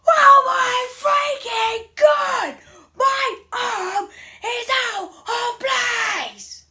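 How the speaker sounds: high, slow screaming in pain. Acted. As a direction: howl out like the arm's broken. Reliving wrist injury pain.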